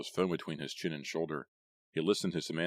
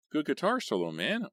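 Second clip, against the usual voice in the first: choking with laughter; 11 semitones higher, 4 dB louder than usual. A narrator's reading. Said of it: "Arnie choked".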